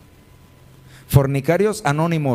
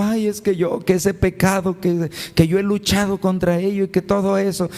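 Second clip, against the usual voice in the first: mock whining; 6 semitones higher than usual. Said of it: "Y luego llegan llorando".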